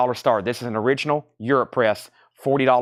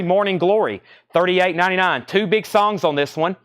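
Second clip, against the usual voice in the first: ordinary delivery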